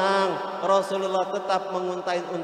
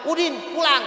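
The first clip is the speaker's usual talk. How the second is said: mother calling; 6 semitones higher, faster than usual. Retold or imitated. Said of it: "Dipanggil lagi sama maknya".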